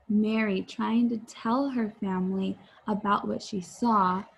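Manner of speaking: usual speaking voice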